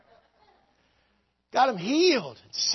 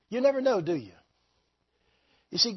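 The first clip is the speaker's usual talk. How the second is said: ordinary talk, nothing marked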